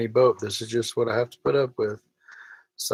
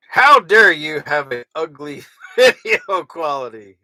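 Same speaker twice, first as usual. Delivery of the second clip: much louder, hammed-up indignant voice; fake outrage; 5 semitones higher, 11 dB louder than usual. Reading a forced mean comment in hammed-up outrage. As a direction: ham up a fake outraged critic. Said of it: "Forced mean comment".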